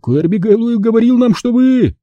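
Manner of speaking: high-pitched, astonished echo; bewildered. A narrator's reading. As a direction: trail off high in disbelief. Echoes the other in bewilderment.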